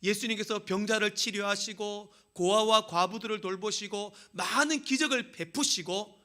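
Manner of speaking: loud high sermon build into praise; fervent preaching into a grateful prayer; preaching at full force. Acted. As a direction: cry out thanks to God from the pulpit.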